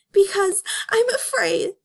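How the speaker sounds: afraid Judy. Acted.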